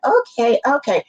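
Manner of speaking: high-pitched, mock-meek okay; retold doubtful agreement. Retold reluctant agreement after being reassured.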